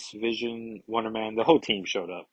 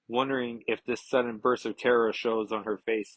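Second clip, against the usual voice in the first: usual speaking voice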